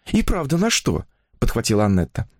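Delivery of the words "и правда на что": higher-pitched chattering heroine; infatuated young woman. A narrator's reading. Woman confiding her new romance to a writer, higher enraptured voice. Woman picks up his question.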